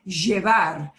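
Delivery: "llevar" with a buzzing zh, zheh-VAR; Buenos Aires pronunciation. Shown as a demonstration. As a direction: demonstrate Argentine "llevar", loud and deliberate. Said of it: "if you're in Buenos Aires".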